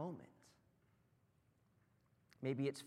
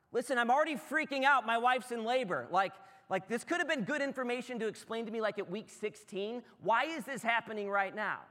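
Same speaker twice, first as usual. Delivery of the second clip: higher and louder, freaking out; panicked outburst; 7 semitones higher, 7 dB louder than usual. Retold or imitated. Husband in labor ward snapping at doctors, panicked. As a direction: blurt it like a panicked new father. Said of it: "I remember being like".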